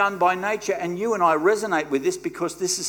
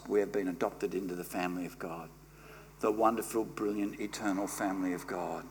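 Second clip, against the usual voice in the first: much lower and softer; tender, quiet preaching; 7 semitones lower, 4 dB quieter than usual. Acted. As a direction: bring it down intimately. Soft reflective close.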